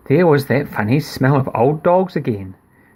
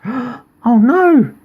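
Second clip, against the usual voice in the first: mock alarm; 10 semitones higher than usual. Reading to a child, hammed-up alarmed cry.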